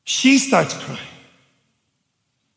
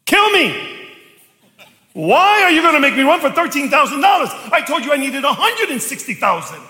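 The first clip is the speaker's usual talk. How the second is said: exasperated outburst; 7 semitones higher than usual. Retold or imitated. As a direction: re-enact a protesting rant to the Father. Acts out his complaint to God.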